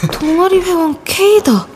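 usual speaking voice

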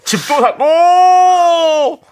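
high, loud, very slow anguished cry; hoarse drama line. Acted. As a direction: wail the line like a tragic hero. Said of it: "그거 대사잖아요". (쉰 목소리로 울부짖는 대사)

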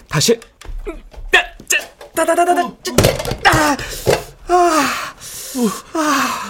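high pained squeals held back; strained high yelps. Real, not acted. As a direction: squeal through the pain. Holds hot pot, suppressing pain.

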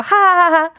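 high, spelt-out ha-ha-ha; playful mock laughter; staged laugh. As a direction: laugh it out deliberately. Laughs at own baguette joke.

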